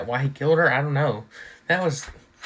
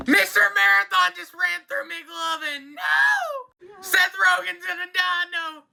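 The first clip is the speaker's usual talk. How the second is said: shocked shouting; 12 semitones higher, slower than usual. Real, not acted. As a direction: yell at the screen as he dies. Beloved actors being killed onscreen.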